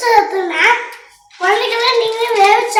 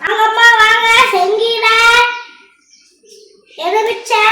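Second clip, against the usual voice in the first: loud, high sing-song hawker cry; street vendor call; 4 semitones higher, 5 dB louder, slower than usual. Acted. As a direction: belt out the wares to the street. Vendor calling out to passersby.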